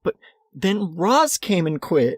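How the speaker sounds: high, mock-tearful wail; crying, upset. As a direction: imitate a leader falling apart. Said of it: "crying. She's upset".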